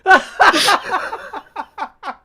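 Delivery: breathless high-pitched cackling fit; hysterical high laughter. Real, not acted. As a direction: lose it completely, wheezing laughter.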